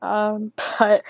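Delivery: winded, catching breath. Real, not acted. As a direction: talk while short of breath. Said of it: "I'm out of breath".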